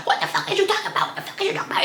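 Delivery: high, breathless mock voice. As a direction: mimic her snapping back, half whispered. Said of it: "I hear on the side".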